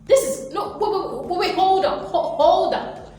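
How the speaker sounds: high, loud, incredulous indignation; hammed-up affronted star demanding recognition; outraged entitled diva. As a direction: play a spoiled person who can't believe they heard no. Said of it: "but you'll be like".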